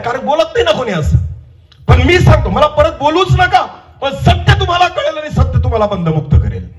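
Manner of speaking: high, defiant preacher's voice; fervent preaching. Real, not acted. Preaches at full force. (आवेशाने चढ्या आवाजात ठासून प्रवचन)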